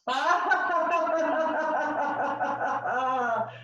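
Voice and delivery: rapid staged laugh, high and descending; exercise laugh. Shown as a demonstration. Leader doing both arms in laughter yoga, staged laugh. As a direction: keep a deliberate laugh going through one long breath. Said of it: "laugh all the way back".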